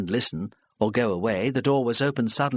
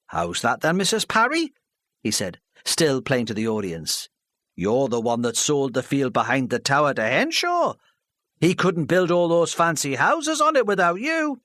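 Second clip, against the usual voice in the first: louder, higher scornful local man; scornful villager; 5 semitones higher, 3 dB louder than usual. Acted. Charlie scoffing at Mrs Parry before the hall, scornful. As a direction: scoff at her plan.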